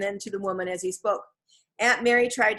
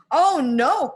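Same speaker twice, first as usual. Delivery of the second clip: alarmed cry, higher and louder; cried out; 7 semitones higher, 8 dB louder than usual. A narrator's reading. Jack dismayed as books burn, crying out. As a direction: gasp it out in dismay. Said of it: "cried Jack".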